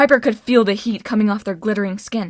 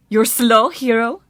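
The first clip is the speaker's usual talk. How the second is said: mildly amused jeer; gloating, light mockery; mocking taunt; 5 dB louder, slower than usual. A narrator's reading. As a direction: taunt the hero with a touch of laughter. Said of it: "she laughed".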